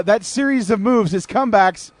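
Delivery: ordinary talk, nothing marked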